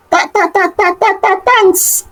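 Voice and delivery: nervous high stammer on ta. Acted. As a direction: stammer ta, ta, ta before thanks. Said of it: "Adanna stammered".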